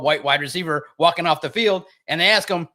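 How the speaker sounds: normal talking voice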